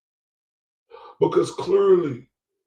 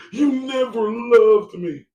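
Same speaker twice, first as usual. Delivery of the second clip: high, loud, drawn-out whine; mock whiny woman; 8 semitones higher, 6 dB louder, slower than usual. Commentator mimics the crying woman.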